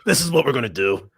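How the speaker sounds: lightly voiced drill sergeant order; a hint of a boot-camp instructor. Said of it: "talking in a drill instructor voice".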